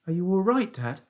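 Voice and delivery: put-on high boyish question; worried young son. A narrator's reading. Son looks down at father in pit, worried. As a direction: ask high and anxiously.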